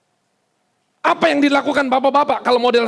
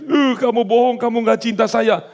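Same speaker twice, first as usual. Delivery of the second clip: whining wife; 2 semitones higher than usual. Retold or imitated. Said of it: "istrinya nangis-nangis".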